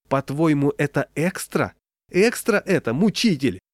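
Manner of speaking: high, indignant, rising outburst; outraged scolding. A narrator's reading. Клава to husband over wrong salt, outraged scolding. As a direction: throw the accusation at him.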